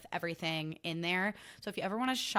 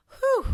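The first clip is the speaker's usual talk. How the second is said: whew; 10 dB louder than usual. Real, not acted. Exhale after ranting.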